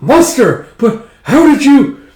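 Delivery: high, loud astonishment; acted shock at a reveal; shocked disbelief. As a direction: gasp out the question, voice leaping up.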